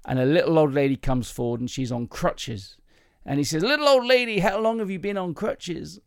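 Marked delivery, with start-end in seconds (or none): 3.6-6.0 put-on high-pitched preacher with a slight American accent; American-accented healing minister, pitched up. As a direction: play an American preacher, not a perfect accent. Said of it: "that's a slight American accent".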